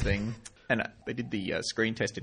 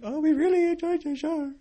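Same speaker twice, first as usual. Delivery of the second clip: high gushing fan voice, much higher; doe-eyed young fans. Retold or imitated. Two young fans gushing at the comedian, high and starry-eyed. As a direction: gush like starstruck young pups. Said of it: "with big doe eyes and went".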